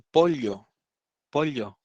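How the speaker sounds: POLLO with a clear l plus y, "polio". Retold or imitated. Narrator trying another pronunciation on the waiter, pollo as "pol-yo". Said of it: "Entonces probamos pollo".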